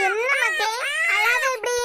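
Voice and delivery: put-on high-pitched pleading over a baby's crying; anxious soothing. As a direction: plead with the child to stop crying. Pleading with a wailing child. (பதறியபடி அழுகையைத் தேற்றுவது)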